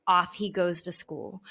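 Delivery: usual speaking voice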